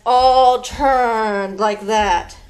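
slow and drawn-out; slow sing-song instruction; slow stretch cue. Shown as a demonstration. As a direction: lean the words over like a hunched back. Leading kids' movement game.

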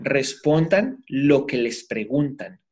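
"Respondan" slow and deliberate, each word weighed; emphatic advice. Shown as a demonstration. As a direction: drive the advice home slowly. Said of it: "Es muy importante esto".

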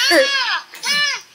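high pretend scream of fright; play scream. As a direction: shriek as if the monster caught you. Kid screams at monster in game.

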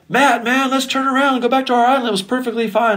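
high pleading mock whine; whiny bandmates. Band members begging producer to go back, high mock whine. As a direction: play the nervous band begging to turn back. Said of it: "And you guys are like".

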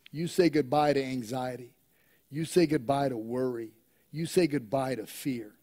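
ordinary delivery

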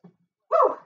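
short, high, loud woo; quick high whoop of effort; exertion whoop. Real, not acted. Trainer done with the exercise, high whoop. Whoop before next round.

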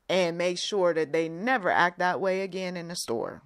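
normal talking voice

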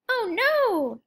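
high, loud cry of alarm; chicks cry out. Acted. Chicks see their father tumble into a bush, high alarmed cry. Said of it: "his chicks cry".

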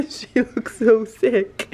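drawn-out words through a laugh. Real, not acted. Owner remarking on her poorly animal, laughing. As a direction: let a laugh run into slow words. Laughing over sick horse.

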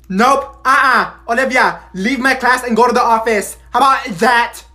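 loud, high order out; angry teacher. Acted. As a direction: send her to the office. Teacher kicking her out.

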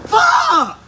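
loud high curse at the cold; shocked shout. Real, not acted. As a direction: yell out from the freezing shock. Second bucket of ice water.

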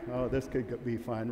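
normal talking voice

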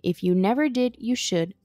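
usual speaking voice

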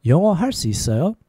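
flowing, syllables run together. Shown as a demonstration. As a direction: ask it at conversational speed. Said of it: "A little faster".

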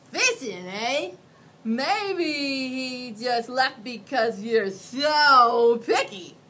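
loud, slow, sneering monster; mocking monster. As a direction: taunt the picky kid. Mac mocks the boy.

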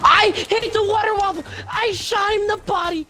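raging. Real, not acted. Raging at the game.

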